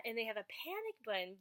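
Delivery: usual speaking voice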